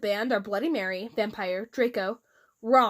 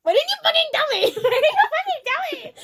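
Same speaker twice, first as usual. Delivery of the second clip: high, loud, laughing shout; 5 dB louder, faster than usual. Acted. Draco yells at her from behind a wall, laughing. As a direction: yell it at her, half laughing. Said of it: "He shouted".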